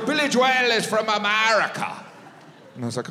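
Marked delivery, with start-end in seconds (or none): 0.1-1.9 high, insistent Irish voice; Irish accent. Retold or imitated. Sound guy setting the American straight, mock Irish accent. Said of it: "He goes".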